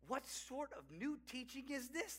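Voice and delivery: softly excited question, pitch lifted; excited asking. A narrator's reading. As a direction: read it as astonished onlookers asking. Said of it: "they asked excitedly".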